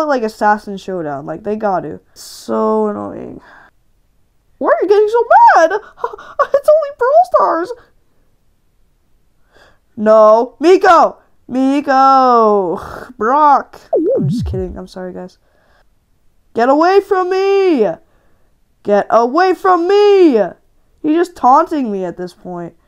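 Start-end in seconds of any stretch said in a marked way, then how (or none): 4.6-7.7 mocking voice. Mocking viewers about his rage. 16.5-20.4 panicked yell. Real, not acted. Gamer yelling at the enemy chasing him, much higher and louder.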